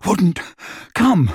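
a light breathless pant. A narrator's reading. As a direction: push it out between breaths. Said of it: "he panted".

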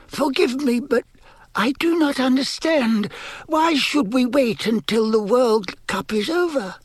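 very high, apologetic voice; timid, fearful servant. A narrator's reading. Servant questioning his lord's plan, timid. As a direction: question him timidly, begging pardon. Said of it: "It sounded timid and fearful".

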